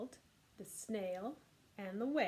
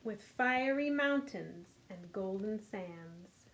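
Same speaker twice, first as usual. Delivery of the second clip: lively storybook read-aloud; reading to children; 2 semitones higher, 7 dB louder, slower than usual. A narrator's reading. Teacher reading The Snail and the Whale to kids. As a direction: read it warmly to a group of little ones. Reads picture book aloud.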